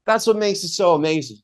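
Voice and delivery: normal talking voice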